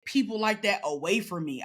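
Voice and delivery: normal talking voice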